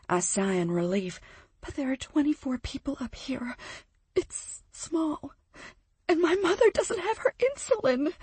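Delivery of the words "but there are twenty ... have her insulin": rising panic. Acted. Friend telling of her mother's missing insulin, panic rising high. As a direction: whisper climbing into a high panicked spike. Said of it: "her voice pitches in an awful spike as her panic rises".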